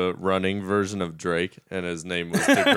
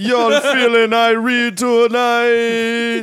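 put-on high, loud, slow Jamaican accent; 12 semitones higher, 5 dB louder, much slower than usual. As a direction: drawl it like a Rasta host. Rastafarian dubbing voice.

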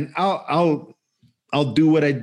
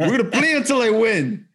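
high, stubborn demand; childish arguing; 9 semitones higher than usual. Retold or imitated. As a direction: refuse to quit until you win, imitating your heated self. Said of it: "man arguing with a seven-year-old".

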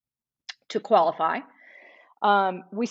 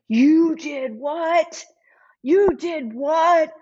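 high, loud, drawn-out disbelief; slow incredulous yell, imitating fury; retold losing it; 7 semitones higher, 5 dB louder, much slower than usual. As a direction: act out an outraged, stretched-out shout. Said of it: "And Tenney loses it".

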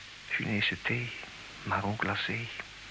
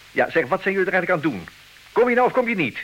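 angry demand; 10 semitones higher, 7 dB louder, faster than usual. Acted. Charles loses patience.

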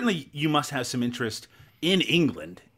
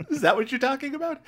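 high-pitched, laughing question; 13 semitones higher than usual. Real, not acted. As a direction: tease through your own laughter. Cracks up after his slang bit.

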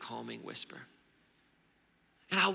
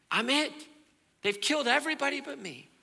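loud and much higher, pleading; desperate outburst; 13 semitones higher, 5 dB louder than usual. Acted. Elijah crying out to God that he's alone. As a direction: cry out that you're the last one.

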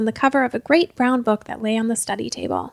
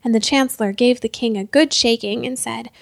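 ordinary delivery, nothing marked

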